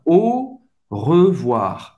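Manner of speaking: "Au revoir" very slowly, first syllable a pure O; slow French model of "Au revoir". Shown as a demonstration. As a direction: stretch "Au revoir" out, sound by sound. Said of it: "tem o som do O".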